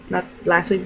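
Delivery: ordinary talk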